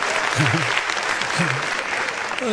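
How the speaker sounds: soft low chuckle; quiet laugh. Real, not acted. Comedian chuckles softly after the punchline. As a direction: laugh quietly at your own joke.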